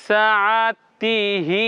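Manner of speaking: সা আ তি হি spelled out slowly, one syllable at a time. Shown as a demonstration. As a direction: drill each syllable slowly. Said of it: "প্রথমে যেমন ধীরে ধীরে বলেছেন".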